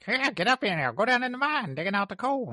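high, loud Southern miner voice; hammy Southern coal miner; Southern accent. Retold or imitated. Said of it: "Southern, like".

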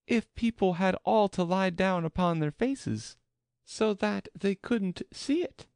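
very high-pitched Alice, puzzling it out; high questioning character voice; Alice's voice. A narrator's reading. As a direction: voice Alice's reasoning far above the narration. Alice's thought voiced in narration.

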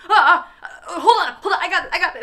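high, loud flustered mock reassurance; mock panic. Elevator guy to shrieking student over a spider, mock panic. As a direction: imitate a flustered guy yelping through laughter.

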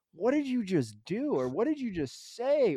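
mock stunned at your kid; exasperated parent. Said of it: "where I'm like".

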